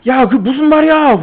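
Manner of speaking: high, loud outraged yell; outraged shout. Retold or imitated. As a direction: blow up at the planner in disbelief. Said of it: "그러면서". (기획자에게 어이없어 버럭 소리침)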